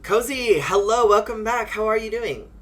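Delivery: high, loud, delighted hello; excited greeting. Real, not acted. Streamer welcoming a viewer back, high excited greeting. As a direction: greet them brightly, pitched right up.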